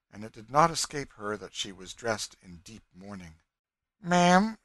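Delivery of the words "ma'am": high, loud ma'am; sudden address. A narrator's reading. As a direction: break in abruptly, pitched up. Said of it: "she said suddenly".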